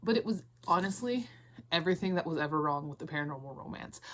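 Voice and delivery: usual speaking voice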